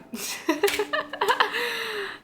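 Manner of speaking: long, high trailing laugh; real laugh. Real, not acted. Vlogger agreeing that algebra makes one scream, long laugh.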